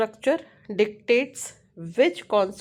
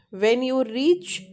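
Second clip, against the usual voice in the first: "reach" drawn out slowly and highlighted; slow down and lean on the key word "reach"; stress on REACH; 2 semitones higher, slower than usual. Shown as a demonstration. Teacher highlighting a keyword for students, stress on "reach". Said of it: "I am giving stress on reach".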